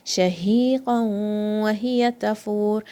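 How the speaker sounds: Shahiqan wa hiya tafur drawn out slowly; slow recitation. Shown as a demonstration. Teacher models the ayah slowly for students. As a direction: recite each letter from its makhraj. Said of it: "Ha is from the bottom".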